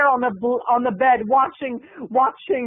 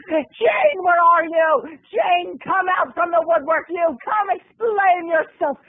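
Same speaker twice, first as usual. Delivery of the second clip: high, shouting for her sister; furious mom; 7 semitones higher, slower than usual. Retold or imitated. Mom hunts through the house for Jane. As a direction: call out in a shrill rage. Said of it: "looking for her sister".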